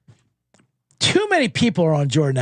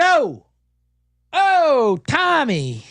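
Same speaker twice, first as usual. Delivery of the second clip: mock coach; 4 semitones higher than usual. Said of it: "That's what they said".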